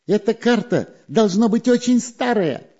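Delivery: high, squeaky little spy; high sneaky spy voice. A narrator's reading. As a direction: pitch him up like a sly weasel.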